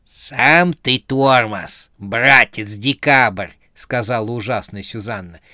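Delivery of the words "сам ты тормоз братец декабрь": slow, loud, scornful put-on voice; menacing Snegurochka. A narrator's reading. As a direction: drag out each word with heavy contempt. Terrible Сюзанна retorts scornfully. (грозно и презрительно, растягивая слова)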